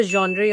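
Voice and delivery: "genre" ending in ee or ay, ZHON-dree; incorrect pronunciation. Shown as a demonstration. Teacher planting the error in a sentence, mispronounced "genre". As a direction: give "genre" a full final vowel. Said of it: "completely wrong".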